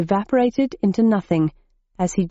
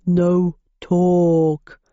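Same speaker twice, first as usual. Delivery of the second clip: basso profundo. A narrator's reading. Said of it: "said the Minotaur in a basso profundo".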